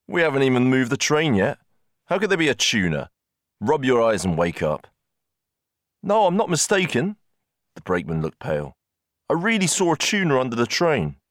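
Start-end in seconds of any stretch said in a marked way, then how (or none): 0.1-4.9 higher, incredulous dismissal; scoffing disbelief. A narrator's reading. Engineer telling the brakeman to wake up, scoffing. 6.0-7.2 high-pitched, agitated protest; urgent insistence. A narrator's reading. Brakeman answering the doubting engineer, urgently insisting. 9.3-11.2 high, agitated plea to be believed; urgent insistence. A narrator's reading. Brakeman convincing the doubting engineer, urgently insisting.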